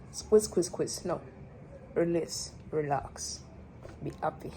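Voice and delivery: coaching, fading to soft; soothing coach. Pretend. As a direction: talk yourself down gently.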